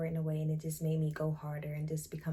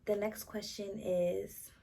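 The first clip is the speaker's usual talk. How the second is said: breathless, slow, gasping between words; winded after sitting down, catching breath; 4 semitones higher, slower than usual. Real, not acted. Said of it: "I'm out of breath and I'm sitting down".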